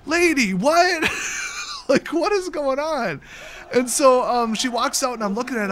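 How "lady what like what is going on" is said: slow, higher mock confusion; a drawn-out, puzzled retold reaction; baffled. Shop owner retells reacting to the pamphlet lady, slow baffled. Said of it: "I'm like".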